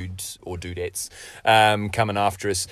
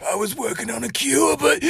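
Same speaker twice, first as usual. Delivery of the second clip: high, trailing off; dying last words. Acted. Dying man cut off confessing, high and fading. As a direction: fade out mid sentence. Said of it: "It's just a bad accent".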